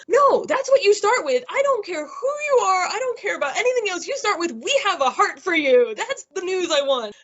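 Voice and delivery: hammed-up indignation, half crying, half laughing; mock outraged rant. Patient scolding the nurse for burying the news, hammed-up and high.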